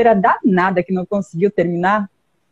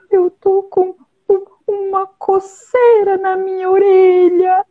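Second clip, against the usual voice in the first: high whiny character voice; rhino character voice; 8 semitones higher than usual. Acted. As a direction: complain like an itchy animal. Rhino voiced complaining itch.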